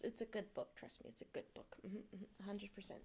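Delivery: ordinary delivery, nothing marked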